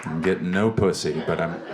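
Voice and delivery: ordinary talk, nothing marked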